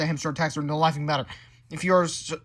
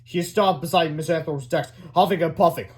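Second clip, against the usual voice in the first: ordinary delivery, nothing marked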